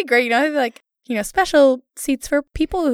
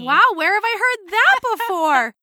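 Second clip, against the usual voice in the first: much higher, mock-amazed sarcasm; exaggerated sarcasm; 10 semitones higher than usual. Host answering friend's dismissal, exaggerated sarcasm. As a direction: overplay the fake wonder, pitched way up.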